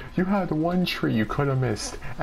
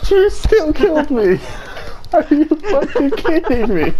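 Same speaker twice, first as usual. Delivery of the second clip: high, loud yell of disbelief; real high-pitched outraged shout; 10 semitones higher, 7 dB louder than usual. Real, not acted. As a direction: yell at a teammate who just killed you. Player outraged at being killed.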